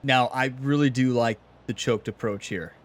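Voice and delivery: ordinary delivery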